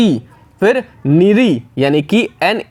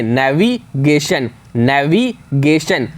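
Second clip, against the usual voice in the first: Navigation drawn out slowly, every letter sounded; much slower than usual. Shown as a demonstration. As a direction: spell it out with your voice, navigation. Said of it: "इस तरीके से बोलिए कि हर एक letter निकल करके आए".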